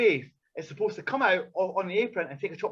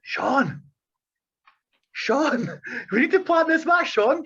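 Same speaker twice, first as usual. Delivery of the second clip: rising calls, getting louder; calling to wake him; 6 semitones higher, 3 dB louder, slower than usual. Retold or imitated. Waking the sleepy wrestler backstage, calling louder each time. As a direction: try to rouse a dozy man. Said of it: "So I was like".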